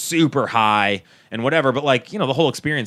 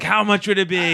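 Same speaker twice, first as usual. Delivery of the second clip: high-pitched begging; mock pleading; 6 semitones higher than usual. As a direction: act out begging to be rescued. Retells begging coast guard for rescue.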